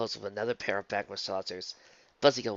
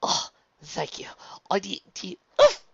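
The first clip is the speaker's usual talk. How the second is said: breathless, higher, broken off; out of breath; 9 semitones higher, 6 dB louder than usual. A narrator's reading. Luna rising from the table, catching her breath. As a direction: pant thanks, then grunt. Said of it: "Luna caught her breath".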